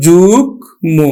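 যুগ্ম said slowly and deliberately; slow model of যুগ্ম; slow teaching demo. Shown as a demonstration. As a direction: lean on যুগ্ম slowly for the class. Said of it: "তাহলে আমরা উচ্চারণ করি".

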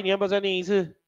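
ordinary talk, nothing marked

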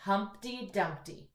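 put-on slow model line for kids to repeat; teacher modelling a line for echo reading. Shown as a demonstration. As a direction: read "Humpty Dumpty" slowly so they can echo. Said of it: "echo reading".

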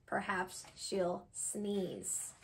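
slow, soft teasing refrain; slow hushed suspense line. Acted. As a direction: drop it down and draw it out for the kids. Refrain read slowly, softly.

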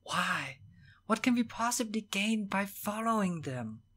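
high, insistent, held-back protest. A narrator's reading. Horace questions Will out of Evelyn's earshot, vehement. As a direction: argue vehemently without raising the volume. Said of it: "none less vehemently".

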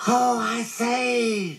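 very high, stretched-out exclamation; tiny excitable squeaker; excited squealing. A narrator's reading. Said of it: "was jumping up and down and making".

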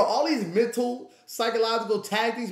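ordinary talk, nothing marked

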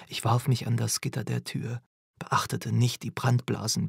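usual speaking voice

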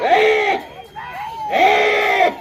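repeated high "hey" cries; urging yells. Real, not acted. Commentator urging on racing bulls, high repeated yells. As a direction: yell twice, high and sustained.

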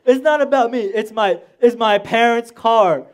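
loud, high retold tirade; pissed-off rant. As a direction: reenact laying down the law, loudly. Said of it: "I was so pissed off".

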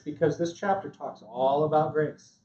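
ordinary delivery

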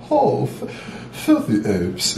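a hint of a snooty British alien tyrant; posh Frieza voice. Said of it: "he talks very posh".

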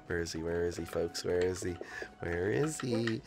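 low, very soft muttering; hushed searching. Real, not acted. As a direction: mutter low while hunting an opponent. Hunting hidden opponent.

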